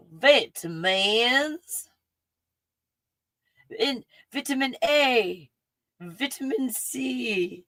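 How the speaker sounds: Vitamins drawn out slowly, VEH-tuh-mans, rising; elongated Texan-drawled Vitamins. Shown as a demonstration. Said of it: "The elongated British accent called Texan".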